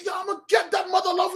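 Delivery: high, hurried, gleefully mad threat; crazed laughing threat. Acted. Saul vowing to get Samuel, madly amused. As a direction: rattle it off like a giddy madman. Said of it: "he was laughing".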